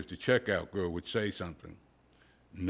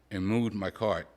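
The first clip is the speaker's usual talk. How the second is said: plain reading voice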